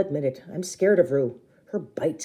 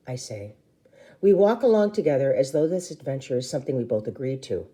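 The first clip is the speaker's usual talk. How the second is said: ordinary delivery, nothing marked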